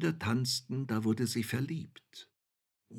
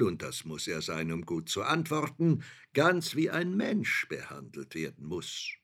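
proud courtier voice; 3 semitones higher, 6 dB louder than usual. Acted. Shadow speaks proudly to princess.

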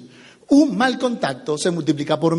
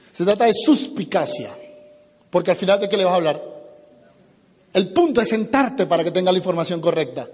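normal talking voice

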